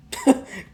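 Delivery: high jeering laugh; bully cackling at the sunk boat; mocking laugh. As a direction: laugh right in his face.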